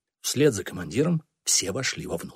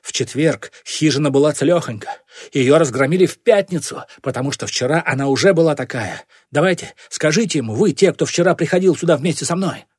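loud, raised, insistent argument; accusing; 5 semitones higher, 6 dB louder than usual. A narrator's reading. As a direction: press the case loudly before witnesses. Commander argues his accusation. (напористое обвинение)

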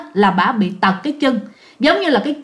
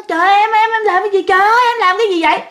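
high, frantic panicked husband; panicked cry; 9 semitones higher, much faster than usual. Retold or imitated. Husband shocked at wife's bleeding, panicked high cry.

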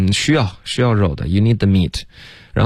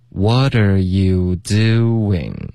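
drawn-out syllables gliding through the lips; very slow model reading, lips sliding; slow demo; 2 semitones lower, much slower than usual. Shown as a demonstration. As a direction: stretch each word out slowly for learners. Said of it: "连续两次这个嘴唇滑动".

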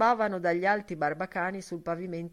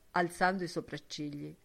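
ordinary delivery, nothing marked

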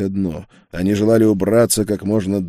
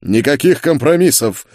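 raised, emphatic dismissal; curt refusal; 7 semitones higher, 6 dB louder than usual. A narrator's reading. Duke refuses any deal, curt and final. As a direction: shut it down, higher and louder. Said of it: "отрезал он".

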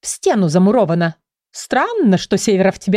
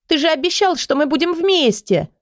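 put-on reproachful girl; 9 semitones higher than usual. A narrator's reading.

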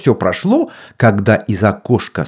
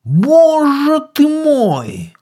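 gasping witch; much slower than usual. A narrator's reading. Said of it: "ахнула ведьма".

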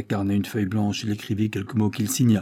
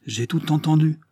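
higher, louder agitated outburst; voice bursting out; 7 semitones higher, 4 dB louder than usual. Acted. Feverish Henri to his father, higher agitated outburst. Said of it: "la voix d'Henri éclata".

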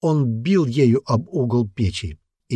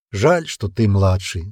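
ordinary delivery

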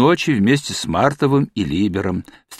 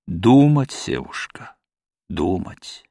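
gentle reproach; 7 semitones lower, slower than usual. A narrator's reading. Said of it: "посмотрел на него с укором".

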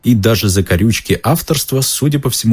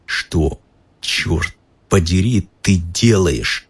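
through gritted teeth; much slower than usual. Acted. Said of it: "сквозь стиснутые зубы".

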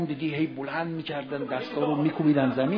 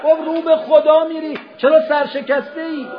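high, loud rallying cry; fervent exhortation; 10 semitones higher, 9 dB louder than usual. Acted. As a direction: lift the crowd, voice ringing high. Preacher exhorts raise your head. (فریاد پرشور و بلند)

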